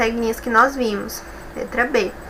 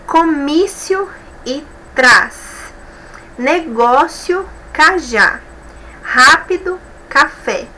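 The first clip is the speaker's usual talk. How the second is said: slow reading of options; 4 semitones higher, slower than usual. Shown as a demonstration. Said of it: "reading the answer options aloud".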